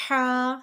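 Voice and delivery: ہا pressed from the middle of the throat, loud and held. Shown as a demonstration. Teacher showing where to press, ہا from mid-throat. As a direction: push ہا out with throat pressure, long vowel. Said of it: "اس پہ تھوڑا pressure آئے گا".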